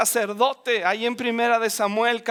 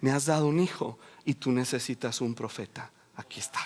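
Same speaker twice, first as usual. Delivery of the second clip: put-on hushed whisper, softer, handing over the child; whispered offering; 11 semitones lower, 7 dB quieter, faster than usual. Hannah whispering to God as she gives up her son. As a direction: breathe it as a quiet offering. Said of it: "ella tomó el hijo y dijo".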